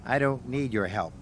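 Edward's quiet proud snub; sniffing. A narrator's reading. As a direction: turn him down with pride. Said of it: "sniffed Edward".

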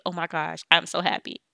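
usual speaking voice

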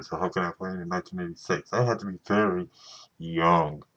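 usual speaking voice

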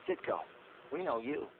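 ordinary talk

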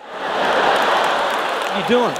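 high, slow, breathy shock; drawn-out startled protest; shocked outrage. Retold or imitated. Stranger reacts to squeezing past.

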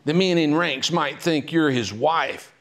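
screechy Mary Lincoln. Retold or imitated. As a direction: scold her as a jealous wife, hammed up. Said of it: "it had some screech to it".

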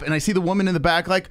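usual speaking voice

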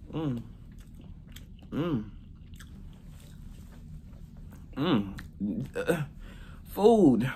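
chewing with closed lips; humming while chewing; mouth full of chicken. Real, not acted. Seen 2: Lips closed, jaw working after bite; holds chicken piece throughout.

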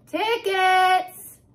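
high, loud chirp; bright little call from the door lady. Acted. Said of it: "chirped the lady".

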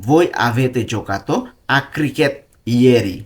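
slow dictation. Shown as a demonstration. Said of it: "Italian কি হবে?".

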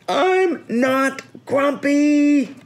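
high, loud, drawn-out yell; outraged shout. A narrator's reading. Jim shouting at all the others, outraged. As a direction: shout each word slowly at full pitch. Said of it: "Jim shouted as he beat his chest".

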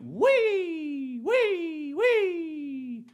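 high squealing drill sound; whee. Retold or imitated.

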